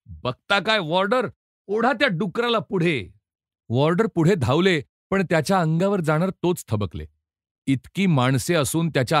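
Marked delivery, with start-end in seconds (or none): 0.1-3.1 angry shout. A narrator's reading. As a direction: yell for the warders to drag him out. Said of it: "जमादार ओरडला".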